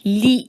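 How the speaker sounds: لی short and thin. Shown as a demonstration. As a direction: clip لی short and light. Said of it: "تو اس کو ہم کریں گے باریک". (لی چھوٹا اور باریک)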